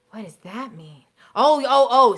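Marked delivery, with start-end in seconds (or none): 1.4-2.2 loud, sudden oh of recognition; surprised realization. Real, not acted. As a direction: catch on all at once.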